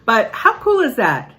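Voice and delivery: high, loud delighted exclaim; excited. Real, not acted. Grandmother delighted by a stranger's praise, high and loud. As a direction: share the thrill.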